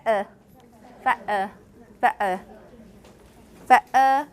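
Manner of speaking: fa-, uh: glottal catch, then a bare schwa; East London schwa ending. Shown as a demonstration. Teacher demonstrates London contrast, fa-, uh ending on schwa. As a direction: drop the N, fa-, uh, throat catch between. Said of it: "syllabic nasal into just a schwa".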